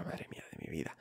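soft whisper of dread. Retold or imitated. To himself, scared of London paperwork, whispered. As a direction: breathe it out, dreading the task. Retold fear of doing paperwork.

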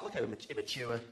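put-on looking down on the boys; sneering girl. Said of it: "Girls like".

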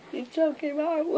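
gleeful cheer. Real, not acted. Cheering a teammate's kill.

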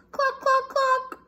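high clucking hen voice; high hen clucks. Acted. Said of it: "Big Mommy Hen says".

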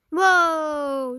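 loud thrilled whoa; excited whoa. Real, not acted. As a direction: let it rip on the drop. Water slide drop.

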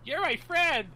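high, sugary fake friendliness; fake sweet greeting. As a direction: coo at him like a pet.

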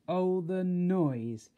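long drawn-out, loud exclamation; slow, booming dismay; loud lament. A narrator's reading. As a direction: stretch it out, overwhelmed by the din. Narrator lamenting a noisy land.

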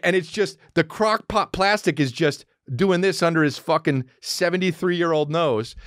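usual speaking voice

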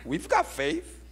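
high-pitched whiny imitation of disciples; mock protest. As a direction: squeak a feeble objection. Imagined disciples protesting to Jesus.

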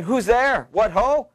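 lightly voiced high call from offstage; a hint of Macbeth calling into the dark. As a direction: call out nervously from within. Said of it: "Macbeth calling from within".

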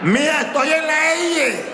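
high, slow shouted call. Retold or imitated. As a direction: shout it down the phone, high and stretched. Calling out on the phone.

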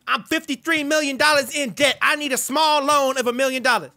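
a put-on Kanye voice, brash and cocky; [name] impression. Trash-talking strangers in game chat, brash Kanye voice. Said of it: "You guys wanna hear my real voice?".

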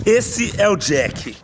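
ordinary talk

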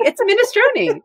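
high-pitched, laughing insistence; laughing correction. Real, not acted. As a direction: declare it through laughter. Settling a pronunciation while laughing.

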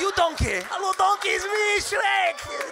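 high, loud hammed-up Shrek shout; mock Shrek yelling for Donkey. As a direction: bellow for Donkey like Shrek, laughing at the end. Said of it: "[name]'s like".

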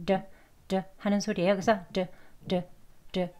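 "D" as a light tap near Korean ㄹ; brief tapped "D", almost an r; soft flap D. Shown as a demonstration. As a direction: flick a soft, short "D". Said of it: "부드러운 D 또는 이제 리을 소리에".

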